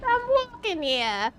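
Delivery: put-on Boston accent try. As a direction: toss off "I'm walking here" in a mock Boston accent. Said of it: "how to do a Boston accent".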